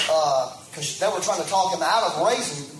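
ordinary delivery

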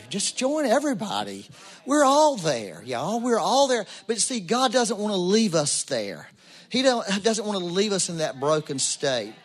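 high-pitched, emphatic sermon delivery; impassioned preaching. Real, not acted. Preaches with rising force.

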